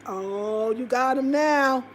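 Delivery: normal talking voice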